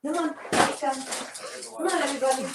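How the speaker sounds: high coaxing call to the dogs; talking to a dog. Real, not acted. Calling the dogs along.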